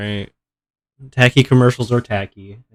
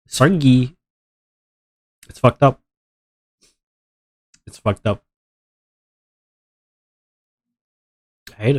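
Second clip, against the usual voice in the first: blocked nose from allergies; stuffy nose. Real, not acted. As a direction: talk through a stuffed-up nose. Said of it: "You sound so stuffy".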